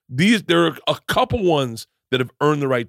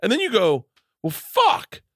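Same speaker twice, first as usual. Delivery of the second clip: high, laughing disbelief rant; exasperated laughing rant. Real, not acted.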